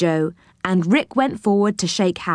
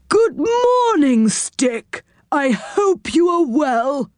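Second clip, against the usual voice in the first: slow, high, singsong greeting; drawn-out high character voice; quirky character greeting; 8 semitones higher, much slower than usual. Acted. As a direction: greet grandly in a high comical voice. Deaf saucepan man greets Rick.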